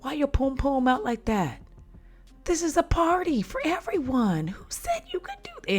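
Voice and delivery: high, hushed whispered aside; shocked whispered gossip; stage whisper. Retold or imitated. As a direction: whisper it up high like a scandalized partygoer. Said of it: "And I'm like".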